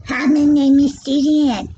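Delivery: imitating a squeaky little girl; lightly squeaky kid voice. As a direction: mimic a young girl's tight little voice. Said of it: "she's little and her little vocal cords are tight".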